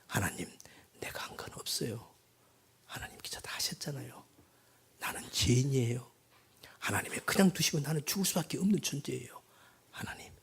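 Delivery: soft, hushed prayer; whispered confession. Acted. Sinner confessing to God, hushed whisper.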